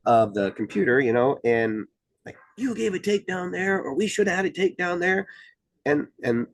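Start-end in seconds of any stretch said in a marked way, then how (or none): 2.6-5.3 high mock protest from an upset coach; an official imitating a coach who complains about a call; worked-up coach. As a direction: play the coach arguing a missed takedown, pitched high. Upset coach voiced in retelling.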